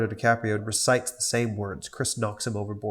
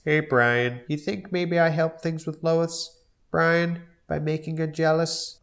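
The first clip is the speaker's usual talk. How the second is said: higher goofy dad voice; Peter voice; 5 semitones higher than usual. Acted. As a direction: ask like a tipsy dopey dad.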